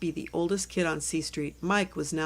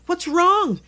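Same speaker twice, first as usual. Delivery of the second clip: high, louder alarmed question; worried mom; 12 semitones higher, 10 dB louder than usual. Acted. Mother running from the kitchen, high and alarmed. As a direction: rush out asking what happened.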